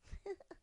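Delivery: high little giggle; a child's delighted giggle; cub giggle. Acted. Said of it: "and giggled".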